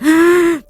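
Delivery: sharp high gasp; reenacted gasp on waking from a nightmare. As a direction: gasp as if jolted awake. Acting out waking in tears.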